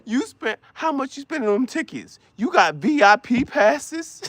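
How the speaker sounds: high, hurt whining man; a hammed-up insecure boyfriend pleading; whiny jealous boyfriend.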